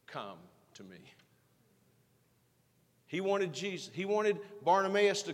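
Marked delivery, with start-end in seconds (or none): 0.1-1.0 tender call. Retold or imitated. Jesus calling, voiced tenderly.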